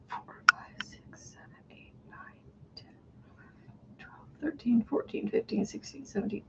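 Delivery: whispered stitch count; counting under breath. Real, not acted.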